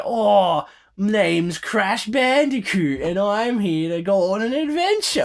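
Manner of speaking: put-on high, slow, hammy Australian accent; overdone fake Australian accent; bad Australian accent. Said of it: "painfully the worst Australian accent I've ever heard".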